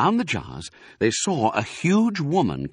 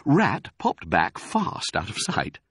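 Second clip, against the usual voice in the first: ordinary delivery, nothing marked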